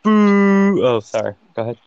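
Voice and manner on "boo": booing. Real, not acted. Booing again, interrupting.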